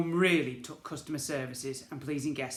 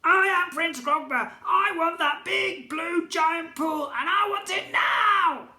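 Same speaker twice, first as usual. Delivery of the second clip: high, loud, slow commanding goblin shout; goblin royal shout; 11 dB louder, slower than usual. A narrator's reading. Goblin prince demanding the sea, high slow shout.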